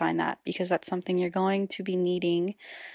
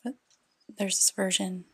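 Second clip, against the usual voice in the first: guided meditation; 6 dB quieter than usual. Acted. Host leads parents through inner child healing, guided meditation. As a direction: guide them gently inward, softly, leaving space between phrases.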